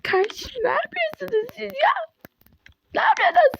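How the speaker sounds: high, shouting after a crash; angry shout. Pretend. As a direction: yell at the driver who cut you off. Crash argument continues.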